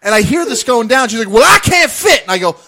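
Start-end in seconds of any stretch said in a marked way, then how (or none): 1.3-2.2 loud, high-pitched imitation of an outraged woman; exaggerated indignant shout; mock indignant yell. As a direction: belt out a mock complaint. Voices angry woman on train.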